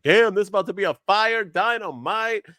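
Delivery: impressed, stretching the big words; retold excitement. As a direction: play up your own earlier hype. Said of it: "I was like".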